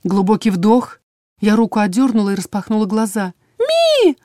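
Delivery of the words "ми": very high little creature squeal; tiny creature piping out one syllable, much higher; drawn-out squeak. A narrator's reading. Small creature Элькат cries out, high drawn-out squeak. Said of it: "протянул Элькат".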